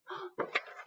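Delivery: sharp breathy gasp of surprise; quick surprised intake of breath; storyteller's gasp. Acted. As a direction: gasp as if you just saw them. Sees monkeys wearing the caps.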